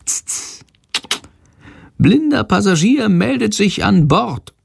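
high bat voice after an unvoiced tut. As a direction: announce himself slyly as the bat. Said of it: "eine vertraute Stimme".

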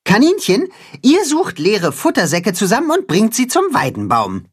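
high, brisk commanding little hen; high cat-hen voice. A narrator's reading. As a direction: give orders like a small general. Katzenhuhn gives orders.

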